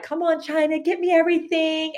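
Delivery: high-pitched pleading to hurry up; impatient urging. Retold or imitated. Author to illustrator while waiting, impatient urging.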